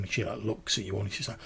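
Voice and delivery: ordinary talk, nothing marked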